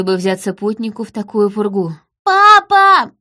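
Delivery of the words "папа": put-on very high, loud child's scream; straining scream. A narrator's reading. Daughter screaming for her father in the blizzard, straining shout. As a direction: scream for father at full stretch. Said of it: "срывая голос, закричала Ири".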